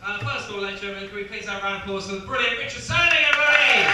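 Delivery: loud introduction building to a high shout; rally the crowd, climbing to a yell; rousing applause call. Real, not acted. Comedian calling for applause.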